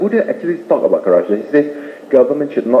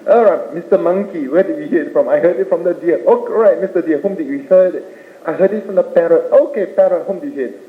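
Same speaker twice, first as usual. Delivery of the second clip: higher-pitched, lightly acted questions and answers; storybook animal dialogue; 6 semitones higher than usual. Lion questioning monkey, deer and parrot, lightly acted dialogue. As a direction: bounce between questioner and witnesses.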